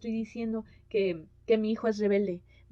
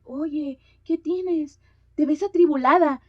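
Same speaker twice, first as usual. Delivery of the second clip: a loud, worried, higher blurt; loud blurted concern; 5 semitones higher, 9 dB louder, slower than usual. Retold or imitated. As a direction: call out your worry without thinking. Mother blurts concern to sad lady.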